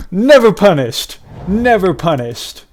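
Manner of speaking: loud, high, slow and drawn-out celebration; triumphant crowing. Real, not acted. Player celebrating a lucky draw after pleading, triumphant crowing. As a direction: crow it out, stretching each word.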